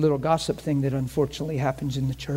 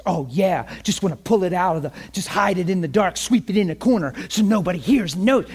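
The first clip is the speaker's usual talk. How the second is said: sarcastic mimicry; 4 semitones higher, 4 dB louder than usual. Quoting accuser's mocking challenge.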